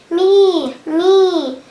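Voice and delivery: goat bleating. A narrator's reading. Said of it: "And the goat bleated".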